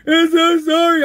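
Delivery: very high, drawn-out mock wail; whining. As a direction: imitate someone whining for sympathy. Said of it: "Whining like".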